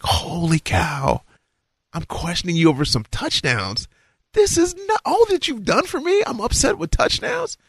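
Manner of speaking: high disbelieving confession; stunned realization. Retold or imitated. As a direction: catch yourself in shock. Sudden realization of pride.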